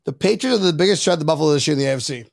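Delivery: rattled off fast; rushed recital. Acted. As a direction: race through the line to get it over with. Acting out giving in and saying it.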